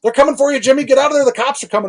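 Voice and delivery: high, loud, frantic shrieking of a warning; panicked neighbour screaming a warning at the raid; retold frantic scream. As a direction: shriek a warning to Jimmy in the house. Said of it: "she starts screaming".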